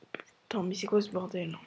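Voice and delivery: whispered exasperated curse; annoyed whisper. Real, not acted. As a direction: mutter it under your breath, fed up. Annoyed at slow game update. (juron chuchoté, agacé)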